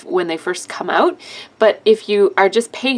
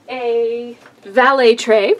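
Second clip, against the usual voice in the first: normal talking voice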